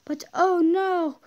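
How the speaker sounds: storyteller's gasp of oh no; mock dismay. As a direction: ham up the plot twist. Dramatic story twist.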